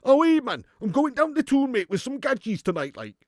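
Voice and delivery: high, loud opening in broad Geordie; Geordie accent. Acted. Streamer showing a tricky accent, high broad Geordie accent. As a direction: play a hammed-up Geordie heading out. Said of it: "Geordie can be a little bit sometimes".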